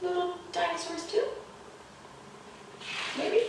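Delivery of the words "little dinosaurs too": usual speaking voice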